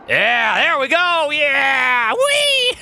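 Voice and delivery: loud, very high whooping; exhilarated cheering. Real, not acted. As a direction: whoop like you're on a wild ride. Build races off at speed.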